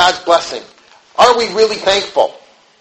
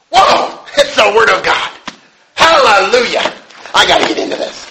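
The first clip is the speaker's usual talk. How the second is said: much higher, loud gasp of delight; thrilled outburst; 4 semitones higher, 4 dB louder than usual. Retold or imitated. Preacher acting a believer thrilled by the Bible, loud and excited. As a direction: act out bursting with excitement over the Bible.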